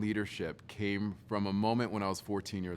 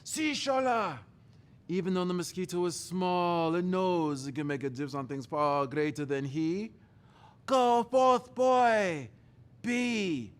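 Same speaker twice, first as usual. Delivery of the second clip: hammed-up father, much higher and louder; dad's proclamation; 8 semitones higher, 4 dB louder than usual. Father sending his son forth, grand and booming.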